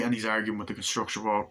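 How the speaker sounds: normal talking voice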